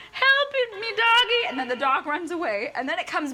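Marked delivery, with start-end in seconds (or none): 0.1-1.5 high, louder mock plea; pleading to the dog. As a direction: beg the dog for help. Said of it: "And she's like".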